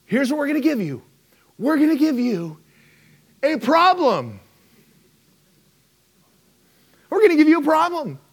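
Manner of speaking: high, booming host reveal; Oprah announcing. Retold or imitated. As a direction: pitch it up like a hyped host. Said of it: "And she said".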